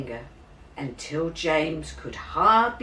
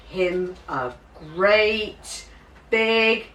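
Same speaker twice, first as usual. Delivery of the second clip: building; 4 semitones higher, 5 dB louder, much slower than usual. A narrator's reading. Climax of the story.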